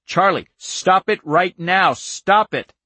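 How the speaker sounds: loud, high desperate command; urgent shout. A narrator's reading. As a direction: shout to make the child stop now. Father desperately orders daughter to stop.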